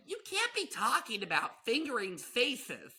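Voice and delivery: high, scandalized mom voice; mock-horrified mom; imitated mother. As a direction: scold like an appalled mother in a put-on high voice. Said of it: "my mother listens to this and she's like".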